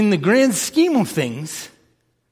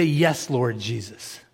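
soft prayer; 8 semitones lower, 5 dB quieter, slower than usual. Acted. Quoting a prayer softly.

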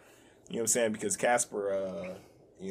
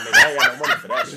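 squeaky mock voice; 12 dB louder, much faster than usual. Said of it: "He's like".

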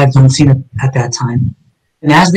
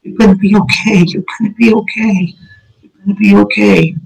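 higher, gentle nurse voice repeating comfort; soothing older nurse; 5 semitones higher than usual. Retold or imitated. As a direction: lightly voice an older nurse calming a patient. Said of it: "She kept saying to me".

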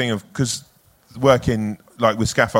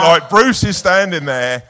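ordinary talk, nothing marked

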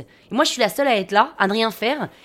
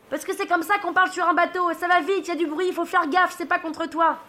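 high-pitched, lightly acted call from the mast; calling from aloft; 6 semitones higher than usual. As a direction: explain it down from up high. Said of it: "me hurle de tout là-haut".

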